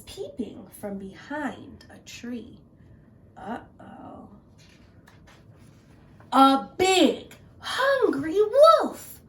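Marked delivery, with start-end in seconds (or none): 3.4-4.3 whispered uh-oh. A narrator's reading. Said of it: "narrator's aside to children". 6.3-9.2 slow, loud and rising on hungry; drawn-out ominous announcement; dramatic reveal. A narrator's reading. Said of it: "dramatic wolf reveal".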